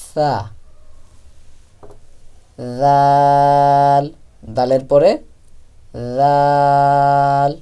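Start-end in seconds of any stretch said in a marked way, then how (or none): 0.1-0.3 soft "tha" for "সা", tongue at the front teeth; very soft articulation. Shown as a demonstration. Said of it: "একেবারেই নরম". 2.8-4.0 "দাল" with a soft voiced "dh", long vowel; tongue tip at teeth, stretch "দাল"; very soft articulation. Shown as a demonstration. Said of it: "একেবারেই নরম". 6.2-7.5 "দাল" with a soft voiced "dh", long vowel; tongue tip at teeth, stretch "দাল"; very soft articulation. Shown as a demonstration. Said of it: "একেবারেই নরম".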